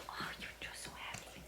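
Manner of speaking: soft fast whisper. Real, not acted. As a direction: whisper it to the dog. Whispered to hiccuping dog.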